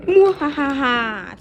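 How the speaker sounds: loud villain cackle; evil laugh. Acted. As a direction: cackle like a mad scientist. Villain train gloats.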